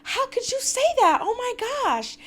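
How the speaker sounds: imitating Brittany's shocked dismay at Jared, high and whiny; mock whiny Brittany. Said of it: "And Brittany's like".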